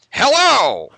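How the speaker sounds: booming, high phone-announcer voice; Moviefone guy. Retold or imitated. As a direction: blare it like a recorded hotline greeting. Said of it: "he actually really talks like that too".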